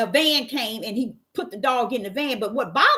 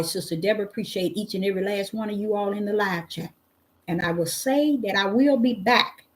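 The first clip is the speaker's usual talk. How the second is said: show sign-off; 3 semitones lower than usual. Acted. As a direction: bless the live chat on the way out. Sign-off in her show persona.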